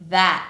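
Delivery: THAT cut off in the throat; no released t on THAT; glottal stop t. Shown as a demonstration. Teacher gives example words, final glottal stop. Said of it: "when the T comes at the end".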